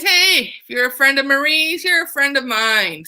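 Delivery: high, hearty welcome as the regiment; cheerful soldiers. Acted. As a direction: boom it out like friendly soldiers. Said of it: "the regiment decides".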